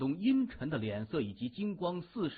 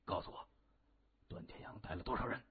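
quick, hushed demand; urgent low voice; 4 semitones lower, 10 dB quieter, faster than usual. A narrator's reading. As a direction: press for an answer under the breath. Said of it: "急切的低声问". (急切地低声追问)

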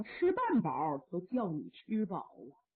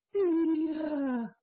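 long, loud, high call; desperate shout; anguished cry; 5 semitones higher, 4 dB louder than usual. Acted. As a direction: cry out after his father. Son cries after father.

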